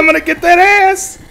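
high, loud hunting taunt; 1 high monster shout. Pretend. Monster chasing Ian down, shouting high and loud.

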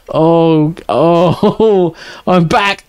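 ordinary talk, nothing marked

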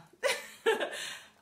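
breathy high-pitched giggle; real giggle at a comment. Real, not acted. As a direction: let a high giggle burst out. Bursts out laughing on camera.

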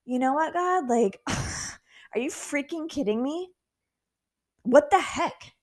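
slow incredulous complaint; can't believe this; frustrated outburst to God; exasperated at God. Retold or imitated. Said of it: "I'm like".

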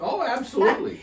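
high, hammed-up enthusiasm; gushing mock delight; mock eager. Real, not acted. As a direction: play up the excitement, half joking. Answering "Aren't you excited?.

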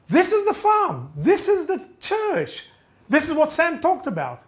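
high-pitched burst of sudden recognition; excited realization. Retold or imitated. Searcher spotting the farm from the story, excited realization. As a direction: exclaim it as the landmarks suddenly click.

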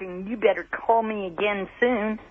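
ordinary talk, nothing marked

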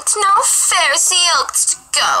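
put-on high, whiny little-girl voice; put-on high-pitched child's protest. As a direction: pipe up like a little girl complaining. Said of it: "I said it like a little girl".

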